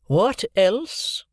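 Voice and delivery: a hint of the aged Miss Havisham; Miss Havisham's voice. A narrator's reading.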